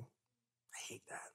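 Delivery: whispered, slow and broken; barely voiced confession. Real, not acted. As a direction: breathe the words out in regret.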